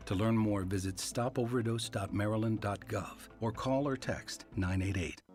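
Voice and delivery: ordinary talk, nothing marked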